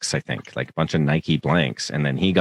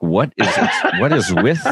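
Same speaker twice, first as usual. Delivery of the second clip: higher, laughing mock worry; laughing question; 7 semitones higher than usual. Patient asking the nurse about blood colour, laughing. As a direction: ask while half laughing. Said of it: "I'm like".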